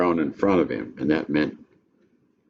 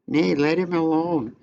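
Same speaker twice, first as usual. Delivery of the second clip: much higher lady's voice; lightly voiced noblewoman; high put-on voice; 11 semitones higher than usual. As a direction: plead as the lady of the house. Olivia's line read aloud.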